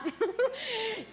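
quick high laugh, breath first. Real, not acted. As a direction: laugh at the human doubt. Laughs after acted doubt. (risa aguda y breve)

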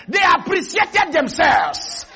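high, exultant preacher's cry; full-force preaching. Real, not acted. Preacher voicing short people praising their own stature, high shouted preaching. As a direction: proclaim it high and ringing.

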